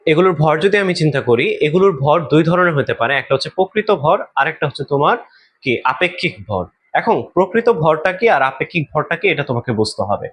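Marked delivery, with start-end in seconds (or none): none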